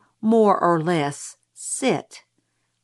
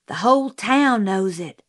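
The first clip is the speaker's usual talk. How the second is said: usual speaking voice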